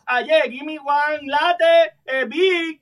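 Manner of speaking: high, slow, halting broken English. Retold or imitated. Ordering coffee at Starbucks in mangled English. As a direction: fumble the order like a nervous tourist. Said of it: "el masticado".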